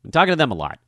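ordinary delivery

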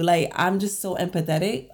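ordinary delivery

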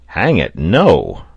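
loud, swooping outburst; emphatic exclamation. A narrator's reading. Pencroft, thinking of his lost tooth, loud exclamation. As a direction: burst out with a hearty denial. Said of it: "exclaimed Pencroft".

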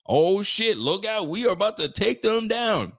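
high, loud mock celebration; mock hype. Fan gloating over the Patriots with hammed-up hype. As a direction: play the cocky fan after a blowout. Mocking fans after a big win.

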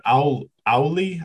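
trying out "Auli", cut off after "Aul-" and restarted; unsure pronunciation. Real, not acted. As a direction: stumble on "Aul-", then attempt "Auli". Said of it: "I don't know how to say it".

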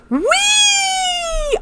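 very high, delighted squeal; imitating gleeful squeal. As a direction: squeal like a kid on a thrill ride. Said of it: "and going".

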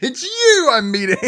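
high, loud, laughing cry of the line; laughing shriek. Acted. Cohost gleefully acting out the reveal, a high laughing shriek. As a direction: shriek it out, cracking up.

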